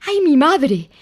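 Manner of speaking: high, loud little gasp of fright; startled cry. A narrator's reading. Piglet near the trap, frightened cry. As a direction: Piglet startled and ready to run. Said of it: "se dijo Porquet y quería echar a correr".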